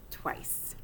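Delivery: soft unvoiced whisper. Hamster confiding he kissed the girl back, whispered. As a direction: breathe the word like a secret. Tender secret at story's end.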